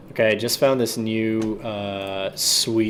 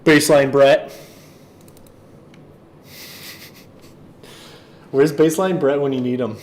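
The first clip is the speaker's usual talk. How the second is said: loud mock Swedish-style Svensson accent; 2 semitones higher, 6 dB louder than usual. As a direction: call out baseline Brett in a hammed-up Svensson accent. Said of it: "you were doing the Svensson accent".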